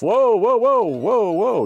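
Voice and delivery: high, loud startled whoas. Real, not acted. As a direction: caught off guard by the wave. Mine nearly hits his ship.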